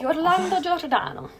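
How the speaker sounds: Yorlando Giordano in a hearty Italian accent. Shown as a demonstration. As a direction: ham up the Italian name. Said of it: "has to be Yorlando".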